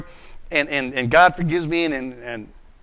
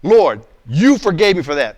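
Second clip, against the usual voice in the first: louder, pushing back at God; defensive protest; 7 dB louder than usual. Retold or imitated. A man excusing himself to God, louder and defensive. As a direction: argue your case with the Lord, louder. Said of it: "Now watch my responses".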